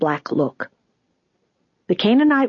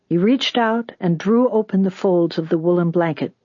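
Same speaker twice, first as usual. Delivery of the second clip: ordinary delivery, nothing marked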